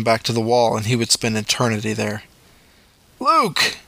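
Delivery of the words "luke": high urgent call of his name; paramedic calling out. A narrator's reading. Paramedic trying to rouse the crash victim, urgent call.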